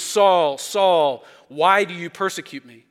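booming voice from heaven. Retold or imitated. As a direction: boom it down from heaven. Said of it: "A voice from heaven boomed".

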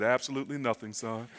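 low, soft, dry answer; deadpan dad. Acted. Dad answers his son about the preacher's watch, deadpan. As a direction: drop it quietly as the unimpressed father. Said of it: "His dad, without missing a beat".

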